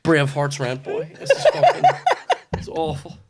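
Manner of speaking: cracking up mid-sentence; high, helpless laugh breaking into the words. Real, not acted. Cracks up at his own outfit.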